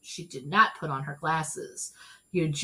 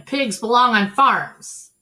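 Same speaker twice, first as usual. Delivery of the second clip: loud, slow, firm pronouncement; firm opinion; 5 semitones higher, 8 dB louder, slower than usual. Acted. Eugenia declaring pigs belong on farms, firmly. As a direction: lay down the law. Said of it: "said Eugenia".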